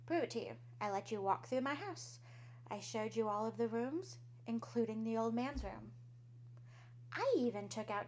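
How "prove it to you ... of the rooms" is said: usual speaking voice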